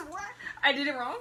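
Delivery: high, laughing disbelief; surprised laugh. Real, not acted. Laughing at failed group sign-off.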